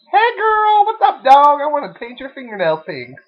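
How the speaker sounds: high, loud, sassy voice. Acted. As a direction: camp it up, sing-song.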